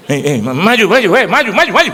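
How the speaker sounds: loud, high, insistent shouting; barked summons. Retold or imitated. Rough preacher ordering people forward, loud barked summons. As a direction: order them up front in mock impatience.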